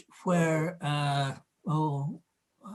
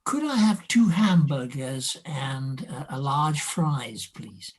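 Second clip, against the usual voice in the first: careful, slower RP English accent; 2 semitones lower than usual. Shown as a demonstration. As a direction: order politely in received pronunciation. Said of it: "show us what you sounded like".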